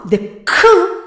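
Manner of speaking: "k" high and loud, clicked; sharp k. Shown as a demonstration. Teacher demonstrating the k sound sharply. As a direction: snap the k.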